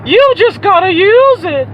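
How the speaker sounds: high, slow mimicry of the seller; mock her in a slow, high-pitched voice; mocking saleswoman. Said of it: "the woman who wants to tell me".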